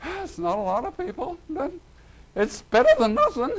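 a hint of a fond, wry Jesus; voicing Jesus. Preacher voicing Jesus watching festival keepers, mild amusement. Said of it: "and he's going".

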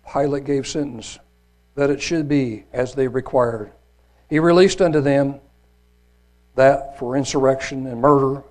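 measured, emphatic Bible reading; scripture reading. A narrator's reading. Preacher reads the trial before Pilate, emphatic scripture reading. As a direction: read it out with solemn weight and stressed key words.

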